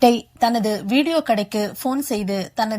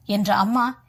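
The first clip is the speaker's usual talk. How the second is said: ordinary delivery, nothing marked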